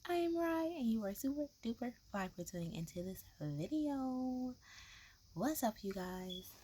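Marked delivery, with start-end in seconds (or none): 0.1-4.5 loud, high-pitched channel intro; hyped intro. Acted. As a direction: belt out the catchphrase.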